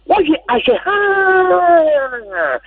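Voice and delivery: very slow, wailing stretched-out cry of dismay; drawn-out lament. Real, not acted. Caller on the radio bemoans the national team, wailing slowly. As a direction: drag the words out in a pained wail.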